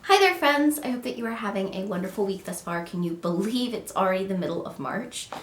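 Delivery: ordinary talk, nothing marked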